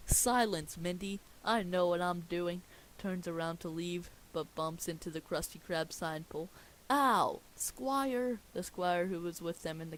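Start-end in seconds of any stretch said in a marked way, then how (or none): 0.1-2.6 loud royal command; commanding shout. Acted. Neptune commands his daughter. 6.9-8.4 loud high yelp of pain; pained yell. Acted. Neptune hits the pole.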